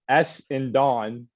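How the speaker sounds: S and Don said slowly, every part spelt out: S, and, Don; overpronounced. Shown as a demonstration. Co-host overpronouncing a team name he got bullied for, S and Don slowly spelt out. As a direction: spell out S and Don, each part slow and apart. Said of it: "extremely pronouncing the S".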